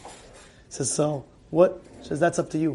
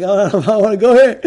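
laughing doubt; 2 semitones higher, 7 dB louder, faster than usual. Retold or imitated. Patient balks at doctor's office.

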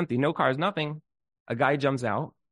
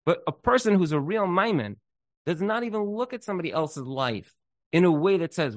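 ordinary talk, nothing marked

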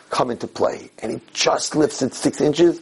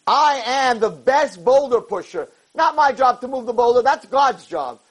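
triumphant boast; 11 semitones higher than usual. Acted. Yaakov answering Satan about his job, high triumphant boast.

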